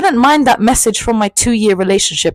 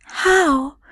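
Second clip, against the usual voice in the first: high eager how; 9 semitones higher than usual. Retold or imitated. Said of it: "And I was like".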